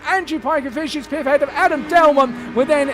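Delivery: high, loud photo-finish commentary; excited finish call. Real, not acted. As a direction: call the chequered flag at full pitch. Final lap finish, photo finish.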